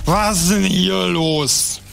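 put-on slow, slurring drunk captain; blackout drunk. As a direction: drag the words out, wasted. (sturzbesoffen und langsam)

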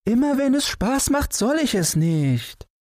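put-on high child voice sulking; sulking boy. Boy complaining to his father, high sulky child voice. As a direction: complain like a pouting little boy.

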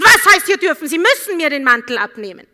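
loud, high, indignant demand; rude passenger. Retold or imitated. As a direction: snap it out arrogantly.

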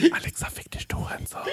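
unvoiced whispered insult to Alexa. Whispering an insult to Alexa in jest, whispered. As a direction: whisper it softly.

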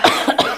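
sharp cough from choking; coughing fit. Real, not acted. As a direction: cough as if swallowed wrong. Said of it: "Ich hab mich verschluckt".

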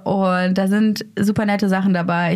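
normal talking voice

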